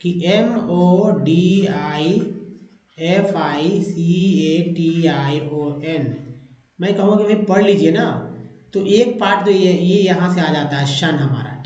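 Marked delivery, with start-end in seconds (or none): 0.3-6.1 M O D I F I C A T I O N spelled one letter at a time, slowly; slow letter spelling. Shown as a demonstration. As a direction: dictate the letters M O D I slowly for the class. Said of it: "मैं लिख दूँ". (धीरे-धीरे spelling बोलना)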